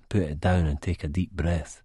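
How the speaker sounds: ordinary delivery, nothing marked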